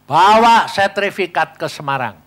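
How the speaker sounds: loud, stressed repeat of the order; emphatic command. Retold or imitated. Preacher repeating God's order to the congregation, loud proclamation.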